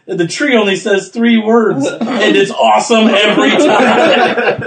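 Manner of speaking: loud, high, slow gushing; hammed-up fan excitement; excited quote. Quoting another Reddit comment.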